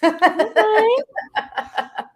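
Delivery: high, loud burst of laughter. Real, not acted. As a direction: crack up at your own joke. Laughing at her own joke.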